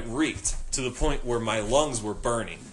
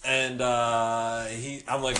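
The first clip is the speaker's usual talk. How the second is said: normal talking voice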